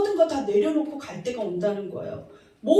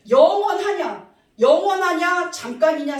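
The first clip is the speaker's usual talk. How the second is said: forceful rhetorical shout; 6 semitones higher, 7 dB louder than usual. Real, not acted. Preacher challenging the congregation.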